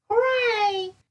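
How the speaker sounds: high, bright-voiced hooray cheer; a sudden happy whoop; cheerful hooray. A narrator's reading. Child's postcard cheer.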